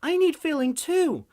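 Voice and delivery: put-on very high, loud little girl's call; yelling child. Little daughter yelling to her parents, high and loud. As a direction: pipe up like a hungry kid. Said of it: "Sauce yells".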